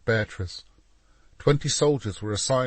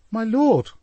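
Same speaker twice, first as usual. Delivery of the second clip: high, louder cry of alarm; distressed cry; 13 semitones higher, 7 dB louder than usual. A narrator's reading. Mistress to Duke, fearing abandonment, crying out. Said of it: "cried Cecilia".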